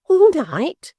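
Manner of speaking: put-on old woman crying out in disbelief; cried out. A narrator's reading. Mrs Jiniwin to her son-in-law, shocked loud cry. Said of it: "cried Mrs. Jiniwin".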